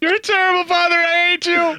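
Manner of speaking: put-on high whiny child voice; whiny kid. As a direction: play the resentful little boy. Mock quarrel, playing resentful son.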